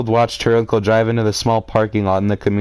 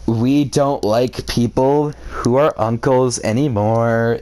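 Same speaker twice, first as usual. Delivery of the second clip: usual speaking voice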